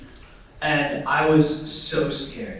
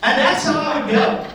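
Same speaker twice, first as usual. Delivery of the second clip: laughing; 10 semitones higher than usual. Real, not acted. Punchline repeated.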